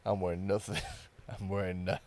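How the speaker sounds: low, soft sultry murmur; phone sex voice. Pretend. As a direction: purr it low and seductive. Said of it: "this is my phone sex voice".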